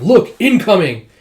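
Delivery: loud slow warning call; shouted warning. Retold or imitated. Warning of attack.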